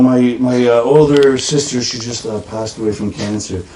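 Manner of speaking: low, a touch mournful; hushed sad confession; mock sad poet.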